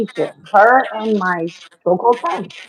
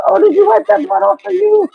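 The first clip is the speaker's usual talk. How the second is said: higher, sing-song jeer; high mocking taunt; 10 semitones higher than usual. Rival on the block taunts her about the blood in a high jeer. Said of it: "She goes".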